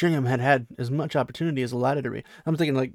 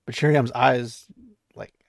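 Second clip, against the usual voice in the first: ordinary talk, nothing marked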